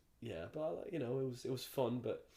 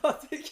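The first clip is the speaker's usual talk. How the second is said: sudden high, loud laugh; burst of laughter; 12 dB louder than usual. Real, not acted. Laughing at own joke.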